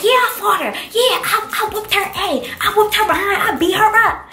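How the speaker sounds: high, excited bragging to mom; gloating brag. Retold or imitated. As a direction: crow about winning the fight.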